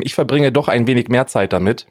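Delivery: usual speaking voice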